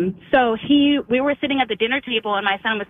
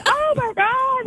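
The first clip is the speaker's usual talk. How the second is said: overwhelmed oh my God; much slower than usual. Retold or imitated. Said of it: "I'm just like".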